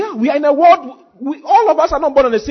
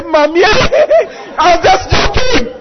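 mock panic; 8 semitones higher, much slower than usual. Preacher acting a David who backs out, mock panic. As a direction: cry out for mommy in hammed-up cowardice. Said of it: "David didn't say".

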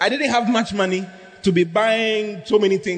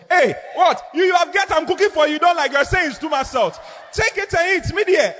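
high-pitched indignant shouting; angry outburst; 9 semitones higher than usual. Acted. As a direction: snap back at him, offended and furious. Wife retorts over salty food.

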